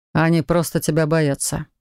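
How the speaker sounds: ordinary delivery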